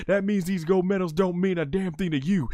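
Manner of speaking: imitating Stone Cold. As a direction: spit it out with contempt. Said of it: "and he says".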